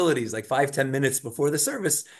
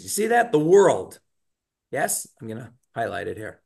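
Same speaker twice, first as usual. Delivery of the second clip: ordinary talk, nothing marked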